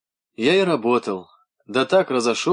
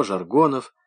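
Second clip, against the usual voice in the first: ordinary delivery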